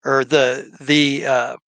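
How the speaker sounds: ordinary talk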